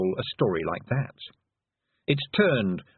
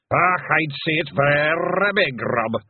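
loud, high Scots bard, drawn out; slow declaiming Scottish pixie; William the pixie; 6 semitones higher, 5 dB louder, slower than usual. A narrator's reading. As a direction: roll the r's and stretch it grandly.

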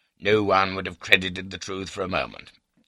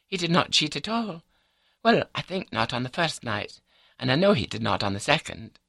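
higher, matter-of-fact wife's voice; composed wife; 10 semitones higher than usual. A narrator's reading. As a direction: voice the wife, pitched up and sure of herself.

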